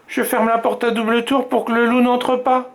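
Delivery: voix d'enfant très aiguë, sérieuse; voix de petite fille. A narrator's reading. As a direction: une fillette qui explique à son papa. Fillette voiced in story. (petite fille, voix aiguë)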